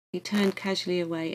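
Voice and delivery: usual speaking voice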